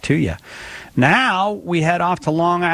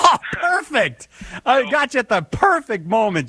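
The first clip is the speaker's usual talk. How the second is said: laughing; 11 semitones higher than usual. Real, not acted. Host laughing at caller eating.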